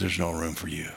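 low, soft and firm refusal; quiet advocate. Acted. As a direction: turn them away quietly, voice dropped low. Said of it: "the advocate comes and goes".

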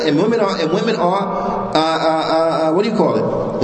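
ordinary talk, nothing marked

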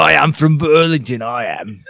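hammed-up, British-style Burlington accent. Friend claiming to be from Burlington, comic accent. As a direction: announce it in a silly Burlington accent. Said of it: "that's a Burlington accent".

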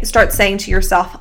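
ordinary talk, nothing marked

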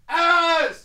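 very loud, high-pitched shout of a name; calling out. Pretend. Host giving absent friend a shout out, full-volume holler. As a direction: holler it as if to someone far away.

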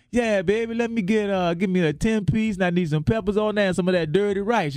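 a hint of New Orleans accent ordering chicken; New Orleans local accent. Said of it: "sound like a local".

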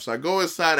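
ordinary delivery

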